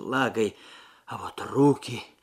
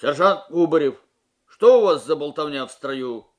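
displeased; 3 semitones higher, 11 dB louder than usual. A narrator's reading. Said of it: "недовольный голос подполковника".

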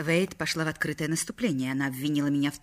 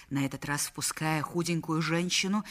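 ordinary delivery, nothing marked

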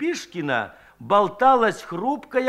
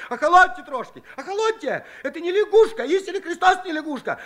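frightened pleading cry; 11 semitones higher, faster than usual. A narrator's reading. Scared Shchukar shouts at the women to stop, high and pleading. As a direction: beg them to calm down. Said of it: "упорно выкрикивал".

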